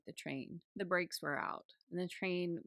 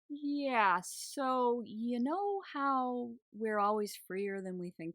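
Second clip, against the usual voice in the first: embarrassed, higher, very slow and drawn out; sheepish; 6 semitones higher, 3 dB louder, slower than usual. Retold or imitated. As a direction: sheepishly admit it, dragging the words. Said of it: "She sounds really sheepish and really embarrassed".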